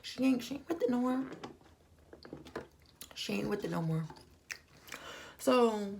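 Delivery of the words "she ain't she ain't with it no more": mock reaction. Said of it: "I was like".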